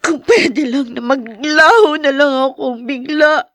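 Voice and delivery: slow, broken sobbing; weeping, half laughing in despair. Acted. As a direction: force each word out through sobs. Overwhelmed daughter breaking down.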